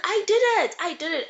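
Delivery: high, loud cry of insistence; desperate insistence. Retold or imitated. Cersei crying out to her father, high and desperate. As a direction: shout it to make them believe.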